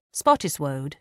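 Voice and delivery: plain reading voice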